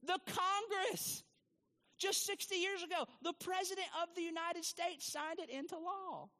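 high, put-on insistent voice; mock defensive. Answering critics in a high mock voice. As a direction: insist with wide-eyed innocence. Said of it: "to which I'm like".

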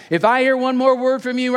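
loud, high parental threat; roaring. Acted. Dad threatening daughter from outside bedroom, loud roar. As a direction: roar like a dad at wit's end. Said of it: "I roared".

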